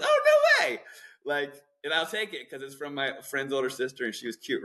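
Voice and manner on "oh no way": squealing much higher and louder, laughing through it; a hammed-up gasp of disbelief, way up high; mock amazement. Retelling his naive younger self reacting to his friend's sister, mock-amazed laughing squeal. Retold shock at food ingredients.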